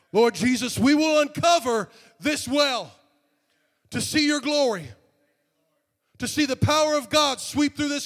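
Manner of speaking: rising to a high, loud preaching cry; fervent prayer. Acted. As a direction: pray it out at full force, building. Prayer builds to full force.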